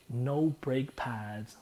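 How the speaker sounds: slow, spaced-out quote; drawn out lyric. Retold or imitated. Quoting the verse, slowly and spaced out. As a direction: stretch each word.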